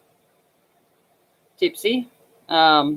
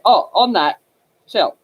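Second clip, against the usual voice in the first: ordinary talk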